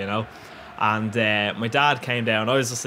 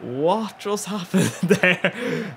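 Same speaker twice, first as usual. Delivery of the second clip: dazed confusion; 6 semitones higher, much slower than usual. Retold or imitated. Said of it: "came to my senses".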